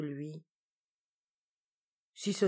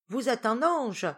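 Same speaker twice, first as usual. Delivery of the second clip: louder and higher, for deaf ears; projected compliment to someone hard of hearing; raised voice; 7 semitones higher, 6 dB louder than usual. A narrator's reading. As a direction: say it up loud so she hears. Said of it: "dit Terborch à haute voix".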